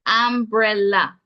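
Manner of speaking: Umbrella with an open A, ambrella; slow demo: ambrella, ending in a clear a; Jamaican pronunciation. Shown as a demonstration. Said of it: "the pronunciation is umbrella".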